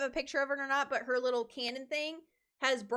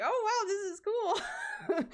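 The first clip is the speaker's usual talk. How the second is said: higher, slow, laughing with delight; 10 semitones higher, slower than usual. Retold or imitated. Cosplayer reacting to an excited fan, laughing delight. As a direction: savor it through a laugh. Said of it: "I was like".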